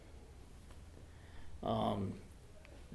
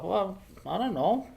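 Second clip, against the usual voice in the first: mock innocent; 10 semitones higher than usual. Boy feigning innocence.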